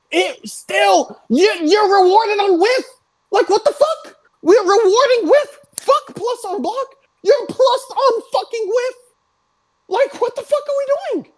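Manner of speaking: so outraged the voice breaks up high; cracking, squeaky outrage at the patch. Real, not acted. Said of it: "my voice is sitting there cracking".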